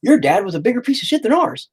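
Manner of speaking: high, sing-song sarcastic praise; mock congratulation. Man mocking a kid's bragging, high and sarcastic. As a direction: hand out a fake award with a sneer. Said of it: "a goddamn trophy saying".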